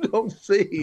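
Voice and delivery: laughing through the repeated phrase. Real, not acted. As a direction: chuckle as the point lands. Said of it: "the number one ability you need in comedy".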